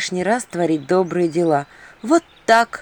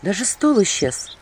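ordinary delivery, nothing marked

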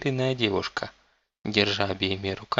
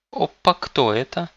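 whiny spoiled pouty drawl; 6 semitones higher, 5 dB louder than usual. A narrator's reading. Spoiled girl character speaks.

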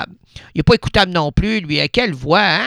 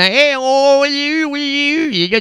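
put-on newborn baby voice; 8 semitones higher than usual. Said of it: "en disant".

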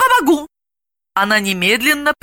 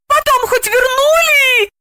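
slow, very high anguished wail; suffering moan; 4 dB louder, slower than usual. A narrator's reading. Galina agonises over the seized money, high suffering wail. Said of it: "страдальчески простонала она".

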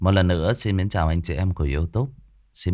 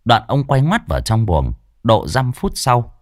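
louder, lively horror-story telling; story narration; 4 semitones higher, 6 dB louder than usual. A narrator's reading. Narrator telling the ghost story to listeners, lively and loud. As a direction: narrate the night scene with energy.

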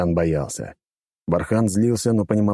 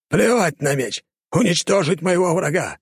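angry command; 11 semitones higher than usual. Acted. Villain angrily overrules.

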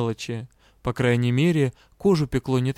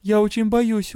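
frightened; 7 semitones higher than usual. Acted. Heroine admits her fear.

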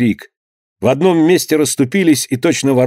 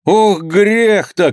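high, very drawn-out lament; slow, high exclamation; cry from crowd; 8 semitones higher, much slower than usual. A narrator's reading. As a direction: wail it out from the crowd. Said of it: "крикнул кто-то в толпе".

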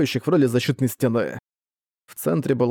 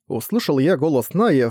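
ordinary delivery, nothing marked

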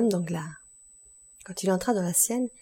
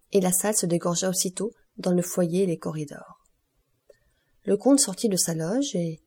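plain reading voice

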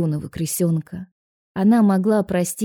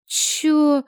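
much higher startled yelp; higher, lightly voiced frightened waitress; 9 semitones higher than usual. A narrator's reading. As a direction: caught off guard, squeak it. Galya startled.

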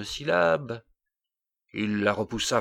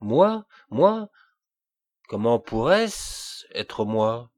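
exclaiming; 6 dB louder, slower than usual. A narrator's reading. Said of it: "il s'écria simplement".

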